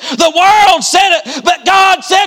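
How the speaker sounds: rising into a shouted, climactic preach; preaching at full force. Acted. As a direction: revival preacher climbing to a shout.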